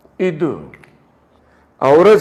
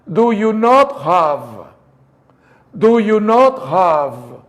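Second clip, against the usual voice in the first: slow, "not" stressed and falling; slow three-beat; slower than usual. Shown as a demonstration. Teacher slowly modelling the three sounds for the class. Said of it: "Hay tres sonidos".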